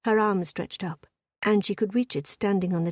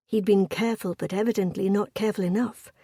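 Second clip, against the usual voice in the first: ordinary delivery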